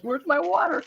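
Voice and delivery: retold whine. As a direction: demand it like a spoiled child. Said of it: "I'm like".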